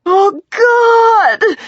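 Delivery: high, loud scream of agony. A narrator's reading. Injured woman on the hospital board, screamed out. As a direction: scream it, sobbing with pain. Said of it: "she screamed out".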